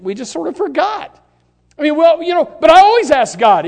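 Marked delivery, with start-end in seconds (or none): 2.0-3.6 loud, higher, smug insistence; mock self-assured believer. Preacher mocking a smug churchgoer, raised voice. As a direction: put on a believer who's sure he always prays.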